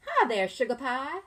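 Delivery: loud, high, friendly diner lady; Miss Delphine greeting. Acted. Teacher reading aloud, voicing diner owner greeting the child. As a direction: call out sweetly to a regular. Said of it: "says Miss Delphine".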